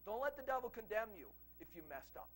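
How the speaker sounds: ordinary talk, nothing marked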